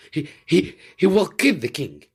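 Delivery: high, loud stammering fear; panicked stammer. Acted. Fritz panics about the king's life. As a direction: choke out the dread.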